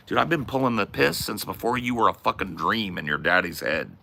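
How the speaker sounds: normal talking voice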